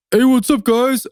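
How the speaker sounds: mock self-introduction. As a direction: parody the kids, hamming it up. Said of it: "And I was like".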